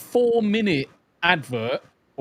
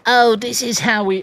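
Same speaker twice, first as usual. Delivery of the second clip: smug mocking voice; lightly mocking the presenter; arsehole voice; 5 semitones higher, 7 dB louder than usual. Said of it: "I need to put on the arsehole voice".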